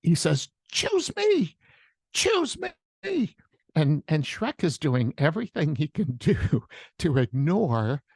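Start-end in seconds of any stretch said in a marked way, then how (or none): 0.7-3.3 very high, squeaky begging to be picked; eager donkey. Acted. Donkey pleading with Shrek to take him along, excited high voice. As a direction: pipe up like an over-eager cartoon sidekick. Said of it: "the donkey is jumping up and down".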